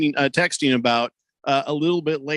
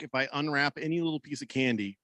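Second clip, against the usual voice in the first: ordinary talk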